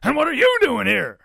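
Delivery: high, loud booming challenge; blustering Papa pig. A narrator's reading. Papa pig demands why Ruby is here, booming. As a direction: boom the question back at the intruder.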